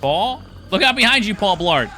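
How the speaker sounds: loud high warning call. Real, not acted. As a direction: shout a warning across. Calling a warning at screen.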